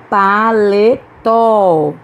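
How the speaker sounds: "Paletó" very slow, syllable by syllable, strong tó; stress on last syllable. Shown as a demonstration. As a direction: stretch out "Paletó", landing firmly on tó. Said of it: "Também é oxítona". ("Paletó" bem devagar, silabado, com força em tó)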